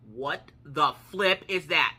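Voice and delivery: loud, drawn-out exclamation; slow, emphatic retold disbelief; freaked-out disbelief. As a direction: stretch out each word, loud and baffled.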